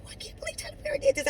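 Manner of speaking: high, fast, faux-scandalized gasp of disbelief; mock shocked. Retelling her reaction to a Tyler Perry show, mock shocked. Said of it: "I had the nerve to say I was shocked".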